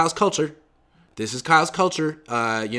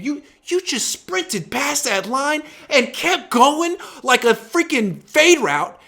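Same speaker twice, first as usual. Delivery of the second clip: high, slow, exasperated scolding; scolding a player for overstepping, slow and exasperated; 10 semitones higher, slower than usual. Real, not acted. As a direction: drag it out in disbelief.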